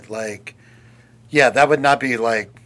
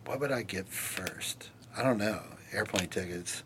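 whispering the question back to herself, lower; whispered to herself; 6 semitones lower, 19 dB quieter than usual. Real, not acted. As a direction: murmur it under your breath, pondering. Repeats question, thinking aloud.

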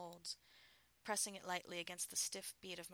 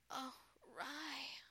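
higher-pitched moaning words; moaned; 7 semitones higher than usual. A narrator's reading. Wife to her husband on a call, moaning. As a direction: moan the name, pitch lifted. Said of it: "I moaned".

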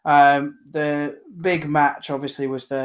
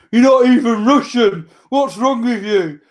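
high, loud indignant Twitter-troll rant; mock outrage; 8 semitones higher, 6 dB louder than usual. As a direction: imitate outraged online critics, pitched way up. Acting out angry people on Twitter.

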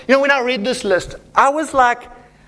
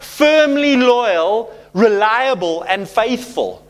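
savoured slowly; much slower than usual. Shown as a demonstration. Preacher presenting the ideal spouse qualities, slow and savoured. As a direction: linger on each word like a treasure. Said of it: "preacher savours the qualities".

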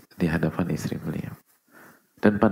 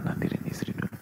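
whispered aside; 8 dB quieter, faster than usual. Acted. Preacher whispering an aside to the congregation. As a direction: drop to a soft whisper.

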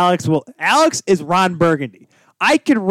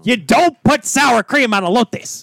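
high, slow, emphatic protest; indignant protest; 8 semitones higher, 3 dB louder, slower than usual. Real, not acted. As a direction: lay down the law in a raised, drawn-out voice. Food argument, protesting over partner.

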